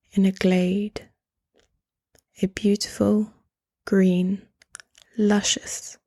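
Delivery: calm meditation voice, leaving space between phrases; guided meditation. Acted. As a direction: gently guide the listener into stillness, phrase by phrase.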